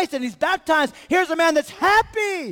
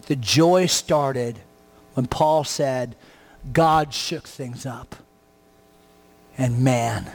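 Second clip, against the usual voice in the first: much lower, slow and drawn out; slow preaching close; 11 semitones lower than usual. Acted. Pastor closing the sermon, slow and low. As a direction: wind the sermon down slowly, low.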